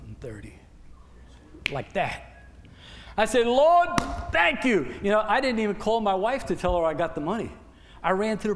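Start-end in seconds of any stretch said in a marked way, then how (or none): 3.5-5.0 thankful cry. Real, not acted. Thanking God for the raise.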